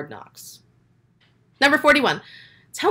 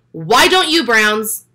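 louder, exasperated shout at the team; frustrated call-out; 2 semitones higher, 7 dB louder, much slower than usual. Real, not acted. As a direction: yell it at the screen like a fed-up fan. Fan calling out at his team.

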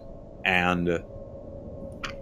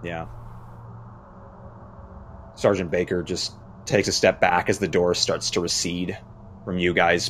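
normal talking voice

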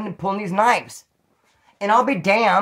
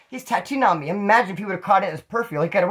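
normal talking voice

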